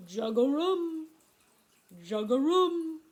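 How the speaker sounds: loud, slow croak rising at the end; bullfrog call. Shown as a demonstration. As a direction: imitate a bullfrog, drawing out the last syllable. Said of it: "Here we have the bullfrog, and he goes".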